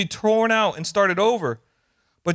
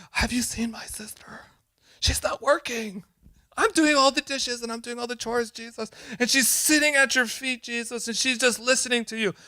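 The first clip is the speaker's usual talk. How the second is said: complaining Martha; 5 semitones higher than usual. Retold or imitated. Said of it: "it's like".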